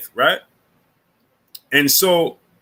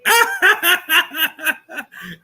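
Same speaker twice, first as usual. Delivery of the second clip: very high, staccato cackle that tails off; high laughing fit; 14 semitones higher than usual. Real, not acted. Host tickled before bringing the kid on, high laughing fit. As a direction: burst into a long high-pitched laugh.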